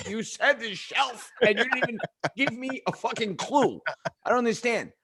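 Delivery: retold son's frustrated protest; exasperated protest. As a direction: argue back like a wronged kid. Son protesting to father.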